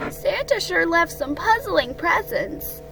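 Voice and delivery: high little creature voice; small, high-pitched wondering; Piglet, puzzled. Acted. Small friend remarking on puzzling presents, high voice.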